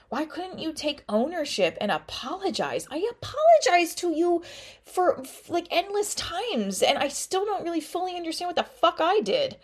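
imagined plea, voice rising. Pretend. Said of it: "I could say to this guy".

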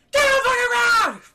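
high, loud scream; full-throated armed-guard yell; guard's shouted order. Acted. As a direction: turn and bellow at the suspects. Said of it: "he goes".

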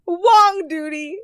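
high, loud, stretched-out Wong Duty; gleeful exclamation. Real, not acted. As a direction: sing out the silly name high and slow. Relishes the silly name again.

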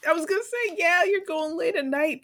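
high, laughing delivery. Real, not acted. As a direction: tease through laughter. Laughing at friend's gym timing.

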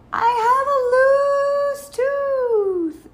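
high, loud drawn-out call across the water; long, high sing-song shout. A narrator's reading. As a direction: sing it out slowly and proudly as a child. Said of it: "Sal called to the loon".

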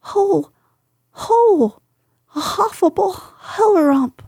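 very high, fast panicked babbling; squeal, tripping over words in terror; crying out. Acted. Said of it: "still crying out".

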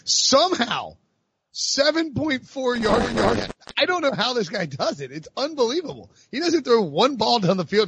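high, amazed stat read; incredulous. Real, not acted. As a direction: marvel in a high, rising-and-falling voice. Marvelling at the quarterback's stats.

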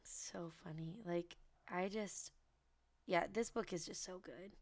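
hushed, almost whispered delight; soft gushing. Real, not acted. Host gushing quietly about a favourite book, hushed delight. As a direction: murmur it like a treasured secret.

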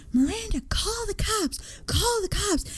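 high, urgent call to the cops; imitating his scream. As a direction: imitate a panicked shout for help. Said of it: "you're, like, screaming and swearing".

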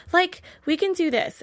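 a hint of a ditzy teen, stressing "Like"; lightly mock the airhead sisters; lightly voiced ditzy valley girl. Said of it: "I counted how many times they said, like".

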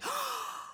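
high, soft intake of breath; astonished gasp. Acted. Mother seeing her daughter's pretty dress, astonished gasp. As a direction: gasp in delighted surprise. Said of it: "she cried".

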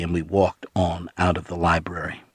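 plain reading voice

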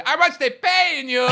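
high, slow, hammed-up jeer at the ref; mock tunnel jeer. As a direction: blare it out like a PA taunt. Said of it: "Massive P A blurting out when he's going".

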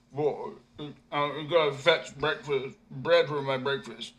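slow, dim-witted mumbling; a slow simple fellow; hungry man. A narrator's reading. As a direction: bread carrier answering the simpleton. Bread carrier answers.

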